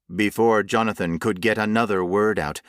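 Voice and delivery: plain reading voice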